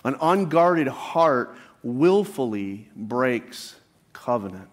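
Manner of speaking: each word laid down slowly; slow repeated emphasis. Shown as a demonstration. Pastor repeating the key point slowly to the congregation. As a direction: say it again slowly so it sinks in. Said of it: "Let me say that again".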